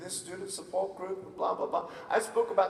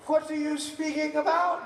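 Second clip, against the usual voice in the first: high-pitched mock interrogation; baffled mom; 9 semitones higher, 4 dB louder than usual. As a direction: question him like an incredulous mother. Mother interrogating her son.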